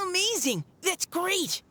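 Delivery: high, bright cheer of joy; excited cheer. Acted. Girl overjoyed that Caliroe will go back. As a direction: burst out with delight.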